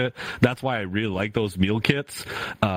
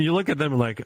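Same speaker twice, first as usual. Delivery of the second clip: ordinary delivery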